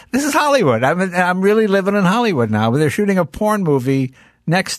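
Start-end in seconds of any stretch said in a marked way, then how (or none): 0.1-2.7 high, wide-eyed mock wonder; mock amazement. Jay marvels at his new neighborhood, high mock wonder. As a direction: sell the wonder of Hollywood, pitched up. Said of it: "I go".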